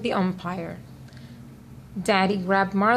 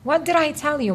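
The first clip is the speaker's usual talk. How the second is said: exasperated scolding; 7 semitones higher, 4 dB louder, faster than usual. A narrator's reading. Scolding the dog.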